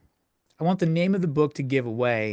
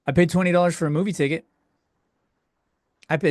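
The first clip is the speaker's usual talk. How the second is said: ordinary talk, nothing marked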